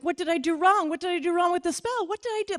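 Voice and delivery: high, frantic questions; panicked. Retold or imitated. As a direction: spiral into panic, pitch climbing. Panicking after rejection.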